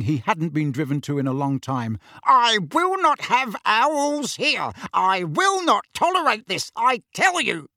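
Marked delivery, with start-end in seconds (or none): 2.2-7.7 high-pitched, emphatic ranting; furious roar. A narrator's reading. Uncle rants about owls in his house, furious roar. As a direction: rant at the top of the range, punching the words. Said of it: "roared Uncle Vernon".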